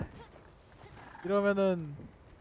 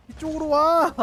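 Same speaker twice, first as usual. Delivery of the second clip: calling out loudly; 7 semitones higher, 5 dB louder, slower than usual. Real, not acted. Calling monster to come.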